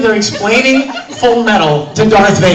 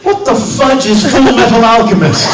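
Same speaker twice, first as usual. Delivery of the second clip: loud, higher mock-indignant outburst; baffled outburst; 5 semitones higher, 7 dB louder than usual. Voice actor imitating David's reaction to the banner, baffled outburst. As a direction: blurt it in hammed-up bafflement.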